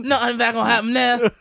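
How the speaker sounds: high, hammed-up Australian; bad Australian accent. Joining the stingray bit, bad Australian accent. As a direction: echo it in fake Australian. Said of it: "worst Australian accent ever".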